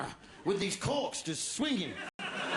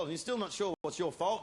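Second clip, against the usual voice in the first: ordinary talk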